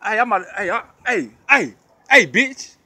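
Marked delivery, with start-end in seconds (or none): none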